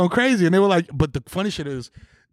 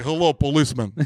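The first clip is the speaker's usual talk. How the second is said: lightly voiced Russian accent, deeper; a hint of a big Russian guy; 6 semitones lower than usual. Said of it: "He's like".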